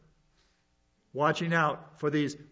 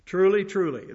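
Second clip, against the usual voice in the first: TRULY, TRULY higher and louder; forceful, raised emphasis on each "truly"; emphatic; 4 semitones higher, 6 dB louder than usual. Shown as a demonstration. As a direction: hit both words of "Truly, truly" hard. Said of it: "that's a way of saying emphatically".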